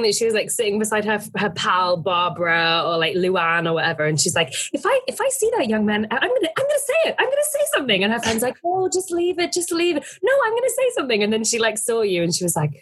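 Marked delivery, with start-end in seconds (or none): 4.7-8.0 high, fast, worked-up imitation; indignant old lady. Old lady telling her pal she'll confront him, indignant. Imagined angry lady ranting to friend. 8.6-10.0 high-voiced imitation of her pal; dissuading friend. Friend talking the old lady out of it, dissuading. 10.2-11.2 very high, rapid mock insistence; defiant old lady. Old lady overruling her pal, defiant.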